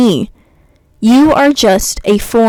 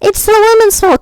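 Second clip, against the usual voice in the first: high, whiny parody of a comment, much higher; mock commenter; 11 semitones higher than usual. Quoting a bad comment to mock it, high sneering parody.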